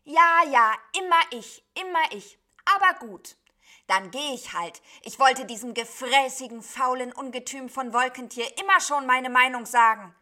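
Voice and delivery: loud, high, aggrieved griping; grumbling back. A narrator's reading. Sheep answers the flock that always picks it, loudly grumbling. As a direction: complain at full volume like a put-upon sheep. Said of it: "Sofort meckerte das meckernde Schaf zurück".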